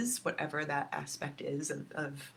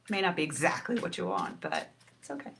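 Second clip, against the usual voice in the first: usual speaking voice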